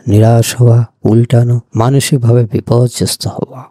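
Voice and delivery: ordinary talk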